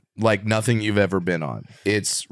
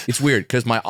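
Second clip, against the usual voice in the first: ordinary talk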